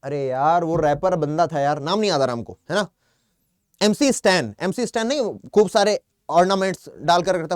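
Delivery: ordinary talk, nothing marked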